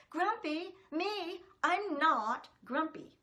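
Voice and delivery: loud, high indignant protest; cross denial shouted high; grumpy monkey. Acted. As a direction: huff it like an offended little monkey. Jim's refrain, grumpy monkey.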